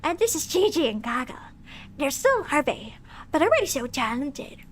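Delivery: lightly voiced proud monster mother; Lady Mance voice. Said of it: "doing her voice".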